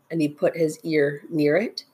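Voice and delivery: ordinary delivery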